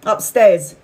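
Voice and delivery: ordinary delivery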